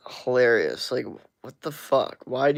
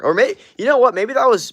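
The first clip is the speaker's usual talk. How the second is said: sudden loud self-interruption; 11 semitones higher, 8 dB louder than usual. Real, not acted.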